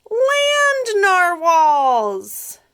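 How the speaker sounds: very slow, high, loud character cry; drawn-out exclamation. Acted. Unicorn greeting the newcomer, slow, high and loud. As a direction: stretch it out in a big excited voice.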